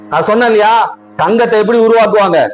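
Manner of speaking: usual speaking voice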